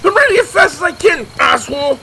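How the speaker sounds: annoyed shout. Acted. Friends sprinting through woods, insulted.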